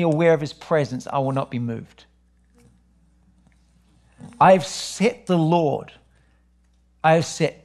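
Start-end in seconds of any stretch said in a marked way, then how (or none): 4.4-7.6 slow, deliberate reread of the verse. Shown as a demonstration. Pastor rereads the psalm slowly to the church. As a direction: linger on each word to let it sink in. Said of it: "repeats the psalm verse".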